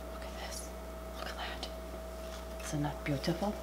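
hushed, reverent whisper; awed whisper. Real, not acted. Vlogger admiring her sandwich in a whisper. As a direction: whisper as if the food might hear. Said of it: "it just makes me whisper".

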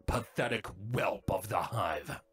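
sneering, contemptuous drone voice; scornful older brother. A narrator's reading. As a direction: scoff down at him with disdain. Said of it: "Pharynx scoffed over him".

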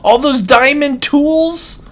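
high, loud, slow disbelief; aghast. Real, not acted. Player realizing his diamond tools are gone, aghast. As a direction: wail over the lost tools.

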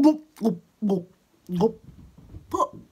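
short gulps, dying away; mock gulping. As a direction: gulp as if being swallowed. Acts being swallowed whole.

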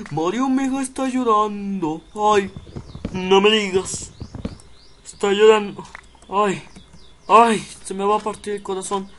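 mock the viewers' heartbreak over a crybaby; mock sympathy. Imita a espectadores burlándose del llanto. (lástima fingida y exagerada)

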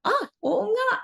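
high-pitched "oh, right"; sudden realisation. Real, not acted. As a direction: pitch leaps up as the time dawns on you. Suddenly notices the time is up.

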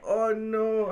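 high, understated cry of dismay; mock shout. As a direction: act out an alarmed cry. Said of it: "at the top of his voice he's shouting".

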